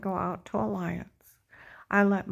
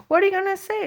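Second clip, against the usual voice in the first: heated demand; 11 semitones higher, 5 dB louder, much faster than usual. Real, not acted. Peak of the rant.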